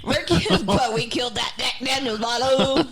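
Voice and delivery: loud laughing boast; laughing shout. Real, not acted. Laughing excited drinking brag.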